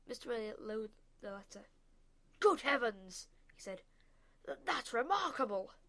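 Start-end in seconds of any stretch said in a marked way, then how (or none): 2.4-5.7 loud, amazed exclamation; be bowled over by it; astonished. A narrator's reading. Father reading the uncanny letter, loud astonishment.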